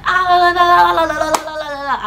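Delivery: high, rapid la-la imitation of a rant; mock scolding babble. Mom hurling insults in Igbo, mimicked as high babble. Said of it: "shouting, speaking Igbo ... back to back insult".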